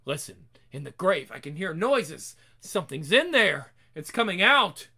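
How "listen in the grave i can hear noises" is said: usual speaking voice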